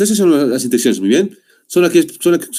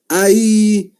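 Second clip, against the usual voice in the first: pain; 9 semitones higher than usual. Shown as a demonstration. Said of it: "de dolor".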